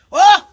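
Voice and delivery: sudden high, loud yelp of fright; startled scream. Real, not acted. Woman startled by the bird she pushes, screaming.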